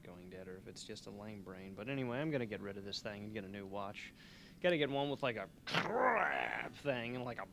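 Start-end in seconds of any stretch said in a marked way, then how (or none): 5.7-6.8 long rough rolled crackle; imitated gadget noise. Host describing the new watch they want, vocal crackle effect. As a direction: make a drawn-out "krrr" noise for a watch part. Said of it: "I don't know the technical terms".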